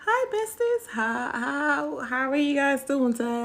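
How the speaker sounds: high bright hi besties; bubbly vlogger greeting. Acted. Greeting her TikTok followers, high bubbly voice.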